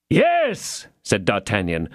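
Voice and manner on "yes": called out higher, raising the voice; raised voice. A narrator's reading. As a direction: call it through the door. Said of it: "raising his voice in his turn".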